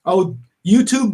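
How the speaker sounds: ordinary talk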